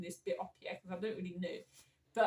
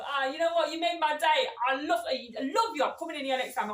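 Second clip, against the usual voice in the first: higher, louder delighted gushing; gushing customer; 5 semitones higher, 6 dB louder than usual. Retold or imitated. Customer telling the cashier they made their day, gushing. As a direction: imitate a customer bubbling over with praise.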